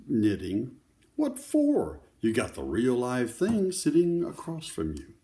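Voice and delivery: lively picture-book read-aloud; reading to children. Acted. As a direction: tell the story to a circle of kids.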